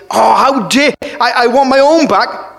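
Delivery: high-pitched mock outrage; acted huffy demand; indignant. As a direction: protest like the wronged party. Mimics our indignant demand for payback.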